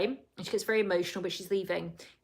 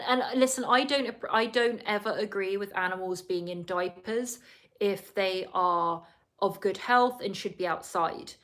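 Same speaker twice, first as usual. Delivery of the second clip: ordinary talk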